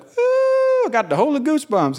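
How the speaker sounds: mock-excited high squeal into quick chatter; imitating Carl's excitement. Preacher imitating a friend excited about Jesus, mock giddy squeal. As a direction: start on a high held squeal, then rush the words. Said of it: "It's when he gets excited".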